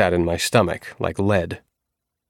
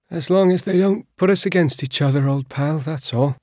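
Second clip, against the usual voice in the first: higher, hopeful prisoner voice; higher voiced old pal; 8 semitones higher than usual. A narrator's reading. Fremi to his cellmate, higher resigned prisoner voice. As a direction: tell your friend it's fine, a bit higher.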